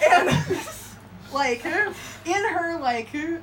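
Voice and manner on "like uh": usual speaking voice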